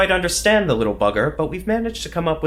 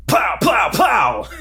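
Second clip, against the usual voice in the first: shouted high mock gun noises; mock gunfire; 8 semitones higher, 5 dB louder, much slower than usual. Agent acting out a shootout, shouted mock gunshots. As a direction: fire off pretend shots out loud.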